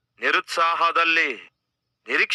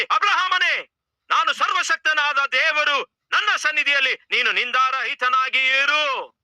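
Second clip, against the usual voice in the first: voice of God; 12 semitones higher than usual. Acted. God appears and commands Abraham, high booming proclamation.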